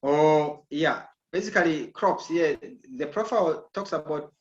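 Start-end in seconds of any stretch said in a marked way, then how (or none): none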